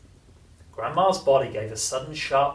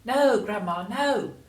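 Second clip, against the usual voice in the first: put-on higher boyish call upward; 10 semitones higher, 4 dB louder, slower than usual. A narrator's reading. George calling up to Grandma, young voice. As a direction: shout a warning up to her. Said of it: "George called back".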